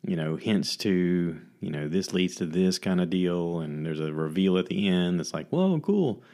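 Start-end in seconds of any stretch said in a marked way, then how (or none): 5.5-6.2 high, wide-eyed mock wonder; imitating an impressed viewer, much higher; mock amazed viewer. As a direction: gush it like the twist just blew your mind. Imitating viewer's reaction to twist.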